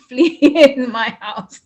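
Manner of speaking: laughs mid-line in relief; laughing. Real, not acted. Telling Veena the fleas are gone, laughing. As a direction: let a laugh break through the words.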